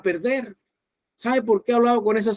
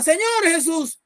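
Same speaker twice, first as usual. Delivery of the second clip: high-pitched imitation of a frantic prayer; desperate crying out; 8 semitones higher than usual. Dying boy repeating the pastor's salvation prayer, desperate crying out. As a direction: cry it out high, as if pleading. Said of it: "y él gritaba".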